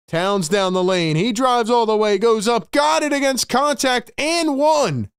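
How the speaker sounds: rising, excited basketball call; play-by-play building in pitch; live play-by-play. Real, not acted.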